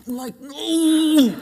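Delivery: high, loud, drawn-out cry; hammed-up squeal of dismay; comic dismay. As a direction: wail it in mock horror. Comic horrified reaction to man's answer.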